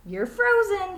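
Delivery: high, loud tag call; playful call. Acted. Jason calls out to Allison during freeze tag, high and loud. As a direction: sing it out like a kid in freeze tag. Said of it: "he said".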